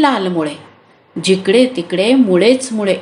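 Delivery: usual speaking voice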